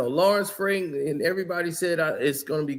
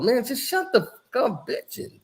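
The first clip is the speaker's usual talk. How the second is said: higher, imitating the coach cursing him out; retold angry brush-off; 6 semitones higher than usual. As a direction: throw it back like the fed-up coach. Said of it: "he talked like that".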